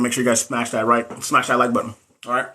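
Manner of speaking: ordinary talk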